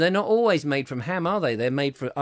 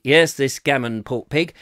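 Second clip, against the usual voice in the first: normal talking voice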